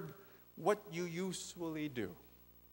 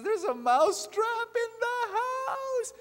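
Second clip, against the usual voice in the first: shrill, crying mouse shout, slow and much higher; tiny mouse crying out for help, much higher and louder; frantic mouse; 9 dB louder, slower than usual. Acted. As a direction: wail the warning like a desperate little mouse. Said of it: "the mouse goes around and says".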